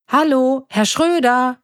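hailing a neighbour; calling out. A narrator's reading. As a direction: call out for him, voice lifted. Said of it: "rief Emily".

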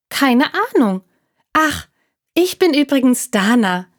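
high squirrel voice, friendly; lightly voiced high squirrel; little squirrel. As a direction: introduce yourself as the small squirrel. Squirrel introduces herself.

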